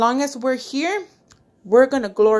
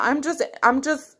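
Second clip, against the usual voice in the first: ordinary talk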